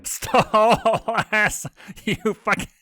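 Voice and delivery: cursing through laughter. Acted. Blitz tickled by Stolas, cursing while laughing. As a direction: swear while cracking up.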